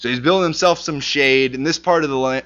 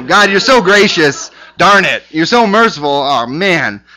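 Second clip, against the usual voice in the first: louder, higher whiny griping at God; mock exasperation; 4 semitones higher, 6 dB louder than usual. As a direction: gripe like a sulking prophet. Mock Jonah complaining to God.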